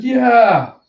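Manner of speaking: high, loud falling yell; rousing cheer. Acted. Crowd responding to Kaido, high loud cheer.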